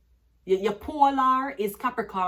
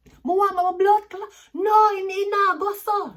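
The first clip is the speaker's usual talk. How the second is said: imitating a furious Jamaican cusser, much higher and louder; street cussing; 12 semitones higher, 10 dB louder than usual. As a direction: shout out the grievance to nobody there.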